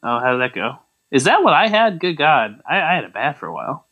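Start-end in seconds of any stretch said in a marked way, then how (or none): none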